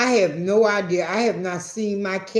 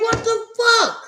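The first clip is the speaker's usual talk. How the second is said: high, stretched shocked cry; shocked what the fuck; 14 semitones higher, 5 dB louder than usual. Retold or imitated. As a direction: squeal it in total disbelief. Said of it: "You're like".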